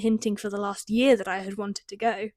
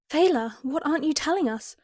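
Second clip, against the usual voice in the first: panicked; 6 semitones higher than usual. A narrator's reading. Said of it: "her voice laced with panic".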